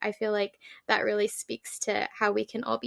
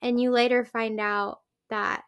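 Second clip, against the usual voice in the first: normal talking voice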